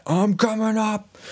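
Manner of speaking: high, slow strained holler; hammed-up enraged Bluebeard; mock yell. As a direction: bellow a threat upstairs without full volume. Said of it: "he yells so loud".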